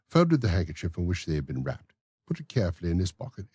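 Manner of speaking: plain reading voice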